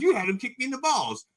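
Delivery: high, whiny accusation; accusing kid. Retold or imitated. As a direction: blame him, squeaky and hurt. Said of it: "He goes".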